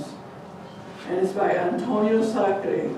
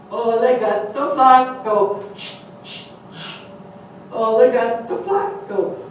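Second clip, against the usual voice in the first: cat gloating; 4 semitones higher, 4 dB louder, slower than usual. Acted. Cat spots the jumping dog.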